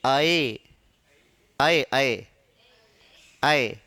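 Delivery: "ऐ" as one open ae vowel, low pitched; low, separate repeats of open ऐ; modern ऐ pronunciation. Shown as a demonstration. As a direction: sound ऐ as open "ae", not "a-ee". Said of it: "अब ऐ ना बोलकर उसको क्या बोलते हैं".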